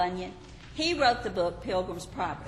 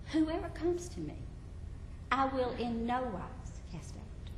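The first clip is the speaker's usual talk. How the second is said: soft, tender promise; Jesus softly assuring the doubter; 4 semitones higher, 6 dB quieter than usual. Acted. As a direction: promise it gently and quietly.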